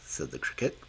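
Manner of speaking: plain reading voice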